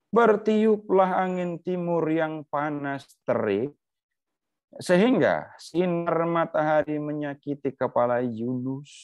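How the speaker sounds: solemn scripture recital; scripture reading. A narrator's reading. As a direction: read the verse slowly and gravely, lifting the pitch. Reads scripture aloud.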